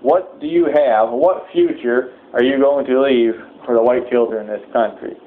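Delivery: ordinary talk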